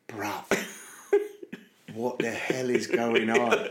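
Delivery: low, slow Cockney disbelief; Cockney John. Retold or imitated. Driver catching his eye in mirror, bewildered Cockney. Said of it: "I could just see him going".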